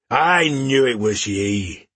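slow gruff dwarf voice; gruff, hearty dwarf greeting. Acted. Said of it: "the dwarf exclaimed".

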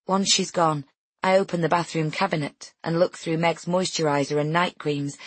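ordinary delivery, nothing marked

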